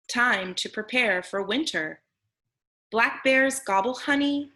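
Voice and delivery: ordinary talk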